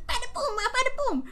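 very high, loud cartoon voice; squeaky little fighter hyping himself up; little guy voice. Host imagining the little clown boxing, high punchy chant. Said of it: "imagine this little guy going".

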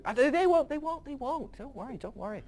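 hammed-up God insisting to angels; nervous insisting. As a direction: squeak out a defensive denial. God insists they won't eat.

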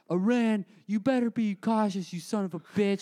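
a hint of Trump threatening Iran; mock Trump tweet. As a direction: bark it like an all-caps tweet. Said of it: "Trump goes immediately to Twitter and goes, all caps".

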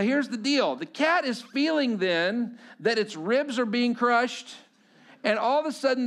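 normal talking voice

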